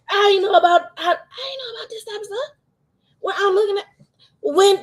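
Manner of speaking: high, breaking voice through tears; fighting back tears while talking; real crying. Real, not acted. Thanking her friend on stream, voice breaking into tears. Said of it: "and I'm trying not to cry".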